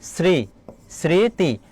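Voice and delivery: শ্রী as a lone "sri", then স্মৃতি as "sri-ti"; syllable-by-syllable pronunciation. Shown as a demonstration. Teacher shows how the spelling is pronounced, broken into careful syllables. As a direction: build it up with pauses, sri... sri-ti. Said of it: "এর উচ্চারণ কি হবে দেখো".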